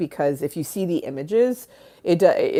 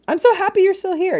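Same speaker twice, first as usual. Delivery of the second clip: high, loud gush of happiness; delighted welcome; 6 dB louder than usual. Real, not acted. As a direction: gush at a friend who stayed. Greeting a returning viewer.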